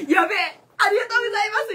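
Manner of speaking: high, loud, laughing thanks; excited yelp. Retold or imitated. 褒められた時の自分を再現して、興奮して大声で. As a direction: burst out in thrilled gratitude. Retold joy after praise.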